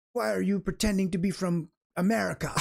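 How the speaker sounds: lightly hammed-up drunk stranger accusing him; drunk angry Swede. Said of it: "he, he got all angry".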